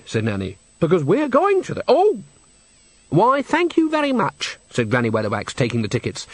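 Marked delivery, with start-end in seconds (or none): 0.8-2.2 much higher, breaking into a sudden oh; cut-off yelp. A narrator's reading. Nanny is stopped while telling their plans, sudden high yelp. 3.1-4.6 much higher, slow gracious thanks; effusive thanks. A narrator's reading. Granny takes the tickets from Enrico, effusively gracious in high pitch.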